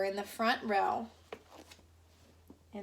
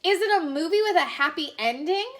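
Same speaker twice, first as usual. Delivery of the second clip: higher and louder, eager question; hopeful boy; 7 semitones higher, 5 dB louder, faster than usual. Acted. Little boy asking about the movie, high and hopeful. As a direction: ask with big hopeful eyes.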